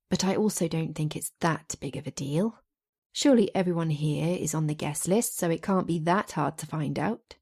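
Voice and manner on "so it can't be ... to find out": usual speaking voice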